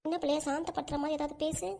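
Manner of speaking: hushed aside to a friend; whispering. Acted. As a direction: whisper it so no one hears. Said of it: "குசு குசுன்னு பேசிக்கிறீங்க".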